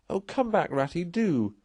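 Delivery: high pleading cry; tearful cry. A narrator's reading. Mole begging Rat to come back, high pleading cry. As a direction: call him back, pleading. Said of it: "cried the Mole".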